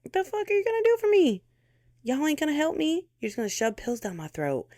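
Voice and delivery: imagined outburst at useless doctors; mock rant at doctors. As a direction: fire it at the doctors like they failed you. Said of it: "'cause it's like".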